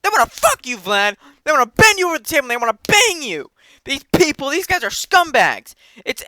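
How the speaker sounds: high, loud frantic ranting; unhinged rant. Retold or imitated. A crony ranting at Vlad about the Reddit traders, high and frantic. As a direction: whip him into a panic, losing control. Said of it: "He starts getting out of control".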